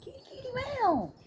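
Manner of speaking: high falsetto cat call; kitty call. Real, not acted. Calling the cat.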